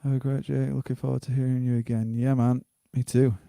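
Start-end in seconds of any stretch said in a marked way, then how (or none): none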